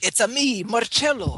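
hammed-up Italian mascot catchphrase; mock Italian accent. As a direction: announce it in a sing-song fake Italian accent. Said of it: "I'm Italian".

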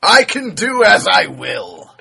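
slow, booming mock-villain shout. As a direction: roar it like a gloating overlord. Mock-villain boast about password.

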